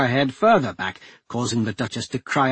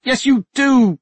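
higher, louder urgent insistence; cried out; 13 semitones higher, 8 dB louder, slower than usual. A narrator's reading. Duchess crying out to Royce, urgent and high. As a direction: cry out, pressing them hard. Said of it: "the Duchess cried".

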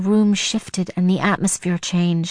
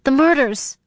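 half-mad cry, higher; frantic cry; 6 semitones higher than usual. A narrator's reading. Sarah crying out to Tony, frantic. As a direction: blurt it out, beside herself. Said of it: "I cried, half out of my mind".

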